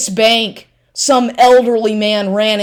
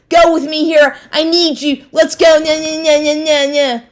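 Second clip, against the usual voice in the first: high, whiny mock of a pushy man; 8 semitones higher than usual. Ex-boyfriend mocked while he pressures her to go out, high whiny mock voice. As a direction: needle him with a sing-song whine.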